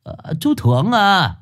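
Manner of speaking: put-on high-pitched old man, puzzled; old coffin maker. Old man asks who has come at night, high and puzzled. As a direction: call out questions to a late-night visitor.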